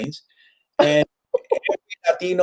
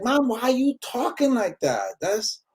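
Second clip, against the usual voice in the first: high, softer incredulous question; mock-bewildered protest, pitched up; baffled son; 7 semitones higher, 6 dB quieter than usual. Son questioning Mom's sudden accent, baffled and high-pitched.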